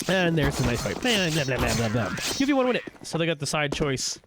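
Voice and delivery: usual speaking voice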